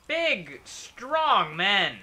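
1 mocking. Mocking enemy heavies.

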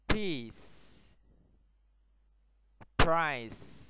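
isolated words, long voiceless hiss ending "bus", "peace", "price"; modelling the S sound. Shown as a demonstration. Teacher has pupils repeat after her, clear hissed S in "bus, peace, price". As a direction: say each word for copying, "S" crisp and held. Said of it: "Now repeat after me".

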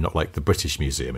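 ordinary talk, nothing marked

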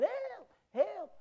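high, loud mock drowning cry; imitated scream for help. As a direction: cry out as if going under. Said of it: "So I'm screaming".

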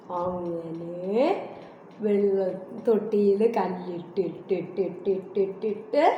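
low, drawn-out show-and-tell voice, slowly; acting out dropping stones. Shown as a demonstration. Showing the child how the crow dropped stones, drawn out low and slow. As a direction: stretch the words low as if dropping stones one by one. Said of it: "ഒന്ന് കാണിച്ചേ".

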